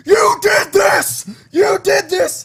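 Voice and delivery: high, slow, full-force yell; imitated furious shout. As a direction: scream the accusation, stretching each word. Retells a beating scene, screamed quote.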